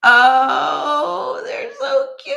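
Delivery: high, tearful squeal over cuteness; loud oh rising into a high coo; overcome with delight. Real, not acted. Collector gushing over gifted dolls, overcome with delight.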